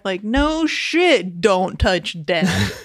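ordinary talk

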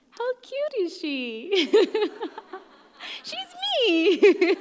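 high, giggly squeal through laughter; laughing delight. Real, not acted. Showing the audience her own childhood photo, laughing delight. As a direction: gush in a high voice, breaking into laughs.

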